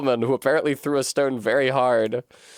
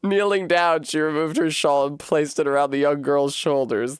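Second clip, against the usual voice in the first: plain reading voice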